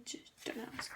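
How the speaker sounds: quick hushed whisper; whispered side remark. Real, not acted. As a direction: drop to a whisper for the aside. Whispered aside in presentation.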